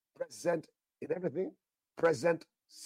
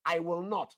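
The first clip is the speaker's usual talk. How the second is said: I will NOT with NOT hit high and loud; emphatic repetition; 4 semitones higher, 3 dB louder than usual. Shown as a demonstration. Preacher repeating God's promise for emphasis, punching NOT. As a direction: hammer home the word not. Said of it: "Three times, all right, for emphasis".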